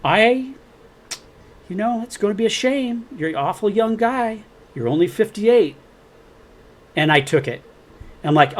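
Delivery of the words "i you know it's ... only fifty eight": higher, mock-menacing caller voice; lightly voiced sinister phone threat; imitating the anonymous caller's veiled threat; threatening caller. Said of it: "And then he said".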